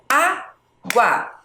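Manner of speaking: "água" slowly, Á, long pause, GUA; split into syllables. Shown as a demonstration. Teacher asking for the syllable count, "água" split in two. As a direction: leave a wide gap inside "água". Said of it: "quantas sílabas?".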